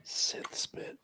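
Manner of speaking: unvoiced-sounding hushed whisper; very soft, secretive whisper; whispered curse. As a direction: whisper the oath to yourself. Second muttered curse behind his hand.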